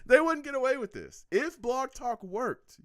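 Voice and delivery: high, loud, cracking up through the words; laughing shout. Real, not acted. Cracking up while teasing chat regulars.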